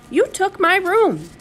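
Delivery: high-pitched, drawn-out protest; indignant accusation. A narrator's reading. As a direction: accuse him, stretching the words up high. Boy accusing Grandpa in quarrel.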